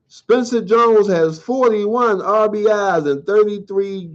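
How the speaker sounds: lightly voiced sports update; headline read. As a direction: announce each story like a news anchor. Reads the baseball headlines.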